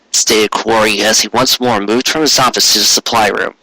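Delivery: ordinary delivery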